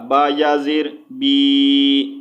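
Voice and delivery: بی stretched very slowly; drawn out bee. Shown as a demonstration. Teacher spells out the madd ya, long بی. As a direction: chant با یا زیر بی with a long بی. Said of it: "وہ ہوگا یا مدہ".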